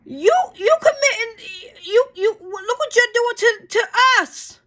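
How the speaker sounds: high, loud, voice cracking into tears; sobbing accusation; crying out. Real, not acted. Near tears, accusing lawmakers of what they do to us. Breaks down mid-rant.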